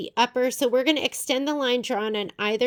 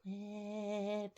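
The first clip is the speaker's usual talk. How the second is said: "reclip" dropped much softer, almost under the breath; trailing off; 15 dB quieter than usual. Real, not acted. Quilter repeats the word to herself, fading out. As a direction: let "reclip" fade away to nothing.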